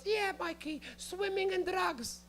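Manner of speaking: high, slow, knowing mom voice; high mock-mom sarcasm; passive-aggressive mom. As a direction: imitate a mother who isn't buying it, drawing the words out. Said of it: "She's being passive aggressive".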